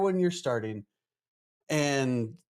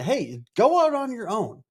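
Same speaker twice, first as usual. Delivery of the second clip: higher-pitched, urging pep talk; cheerleading encouragement; 7 semitones higher, 4 dB louder than usual. Acted. Supporters urging a friend to go solo, cheerleading. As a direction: cheer them on to go for it.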